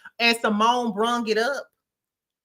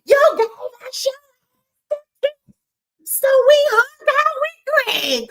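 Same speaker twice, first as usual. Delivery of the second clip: mocking Simone; 8 dB louder than usual. Mocking Simone as Roger Rabbit, high squeaky voice. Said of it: "Doctor Roger Rabbit stands up like".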